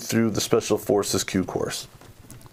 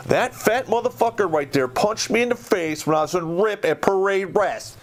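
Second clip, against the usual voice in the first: high, heated accusation; outraged retelling of the punch; angry accusation; 8 semitones higher than usual. Retold or imitated. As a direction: call him out in front of the officers. Accusing sergeant before officers.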